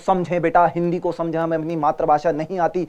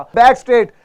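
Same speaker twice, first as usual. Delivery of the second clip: coaching call; 5 semitones higher, 7 dB louder than usual. Real, not acted. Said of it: "coaching posture and breathing".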